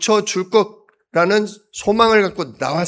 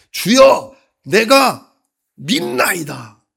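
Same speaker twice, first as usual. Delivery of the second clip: a desperate shout, much higher and louder, slowing at the end; crying out; 5 semitones higher, 4 dB louder, much slower than usual. Acted. The father crying out to Jesus, a desperate shout. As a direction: cry out to the Lord with everything. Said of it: "소리를 질러 이르되".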